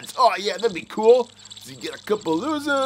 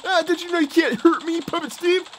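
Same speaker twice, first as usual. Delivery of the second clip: ordinary delivery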